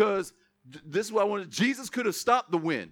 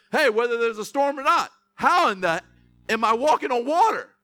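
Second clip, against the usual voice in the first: louder, incredulous exclamation; imagined amazed Peter; 4 semitones higher, 7 dB louder than usual. Acted. Peter amazed to be walking on water, loud incredulity. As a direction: marvel out loud like an astonished fisherman. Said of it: "He should have said".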